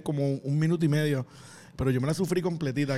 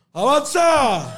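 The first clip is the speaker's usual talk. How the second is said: drawn-out yell, higher and louder; heckler yell; 7 semitones higher, 8 dB louder, much slower than usual. Retold or imitated. Heckler in the crowd yelling at the comedian, long loud yell. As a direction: bellow it like a heckler from the back. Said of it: "yo escucho a alguien gritar".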